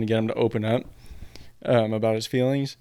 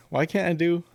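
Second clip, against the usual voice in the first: ordinary talk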